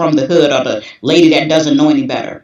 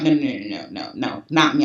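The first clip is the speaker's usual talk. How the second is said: quiet denial; 4 semitones lower, 7 dB quieter than usual. Retold or imitated. Inner voice denying it happened.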